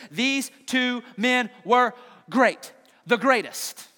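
slow, weighty declaration; slow solemn Jesus. Retold or imitated. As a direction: proclaim it slowly, with gravity. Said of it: "He says".

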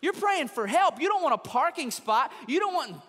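impassioned preaching. Real, not acted. Preacher pleading for those who pray for healing, impassioned and loud. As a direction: preach it with full force, stretching key words.

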